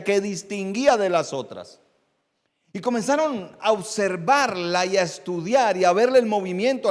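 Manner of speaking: high, forceful sermon delivery; impassioned preaching. Real, not acted. Preacher telling congregation of the wise men's star, impassioned preaching. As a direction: preach it out with rising, ringing emphasis.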